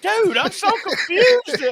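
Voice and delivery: drawn-out helpless voice of total confusion; confused whine. Retold or imitated. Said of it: "I was like".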